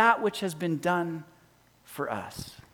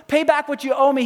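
demanding; 6 semitones higher, 4 dB louder than usual. Acted. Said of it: "he demanded".

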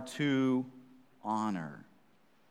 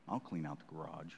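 grudging mumble; 8 semitones lower, 14 dB quieter than usual. Retold or imitated. Kid grudgingly agrees.

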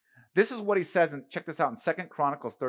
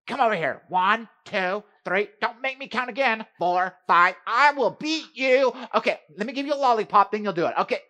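loud, high mock scolding of a child counting down; mall parent scolding; 9 semitones higher, 9 dB louder than usual. Parent at the mall counting down at a kid, loud mock scold. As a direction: ham up a frazzled parent threatening, then caving. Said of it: "You seen that parent, right? You seen him in the mall?".

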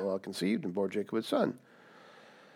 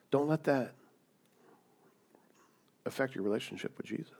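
softer, gentle pastoral advice; hushed pastor's encouragement; soft counsel; 3 semitones lower, 7 dB quieter than usual. Real, not acted. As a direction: lower your voice to comfort. Quiet pastoral counsel after loud part.

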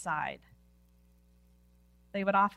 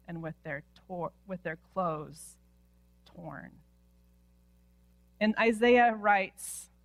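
ordinary talk

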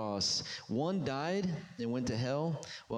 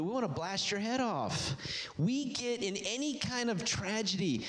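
higher, driving sermon voice; fiery preaching; 8 semitones higher than usual. Acted. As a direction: preach it hard to the congregation. Preaching at full force.

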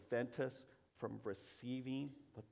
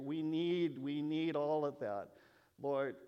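louder and louder, pleading We need; swelling fervent prayer; 3 semitones higher, 8 dB louder, slower than usual. Real, not acted. Praying to the Lord, rising to a fervent loud plea.

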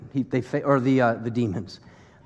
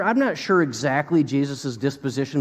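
ordinary talk

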